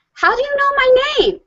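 very high-pitched imitated small boy; little son's voice. Henry asking a strange boy at the lake, high child voice. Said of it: "And Henry said".

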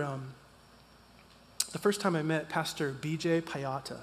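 ordinary delivery

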